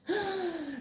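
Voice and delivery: sharp, quiet intake of breath; nervous gasp. Acted. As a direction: gasp like a soloist facing the crowd. Nervous soloist at first performance.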